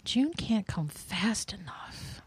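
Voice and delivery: breathy unvoiced whisper. Real, not acted. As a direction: whisper it longingly to yourself. Eager wish said aside.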